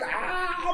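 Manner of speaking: very high squealed ah; retold high-pitched shriek; panicked scream. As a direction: shriek at bugs on you. Earwigs crawling in ears.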